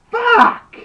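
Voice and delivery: very high, loud pained yell. Acted. Larry yells as Snake grabs his hurt shoulder. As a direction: scream it out in pain. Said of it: "Larry yells".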